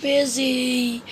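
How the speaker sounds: very slow, drawn-out singsong; Mister Slow answering his pushy neighbour, slowly; slow sing-song reply. Acted. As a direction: drag each word out lazily. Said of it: "replied Mister Slow".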